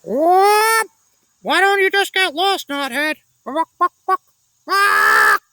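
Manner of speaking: high, loud squawking parrot shout; loud parrot taunt; parrot calling out. A narrator's reading. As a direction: jeer at the fleeing prince like a parrot. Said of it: "the parrot called out".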